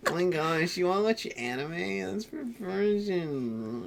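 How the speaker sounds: high, slow, scornful sneer; snobby teen voice. Retold or imitated. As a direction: look down your nose at anime fans. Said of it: "that was still in my phase of".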